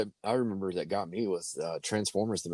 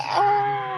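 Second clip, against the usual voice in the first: high scream; 6 dB louder than usual. Retold or imitated. Robot falling into lava.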